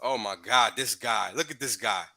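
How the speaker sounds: usual speaking voice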